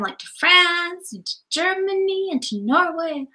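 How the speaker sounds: ordinary delivery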